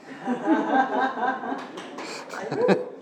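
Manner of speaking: high breathy laugh breaking the reading; burst of laughter. Real, not acted.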